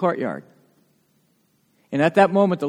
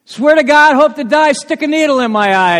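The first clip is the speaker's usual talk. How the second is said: loud, high-pitched insistent swearing; extreme pledge; 10 semitones higher, 9 dB louder than usual. Acted. Peter pledging he is truthful, extreme insistence. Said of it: "an extreme pledge of truthfulness".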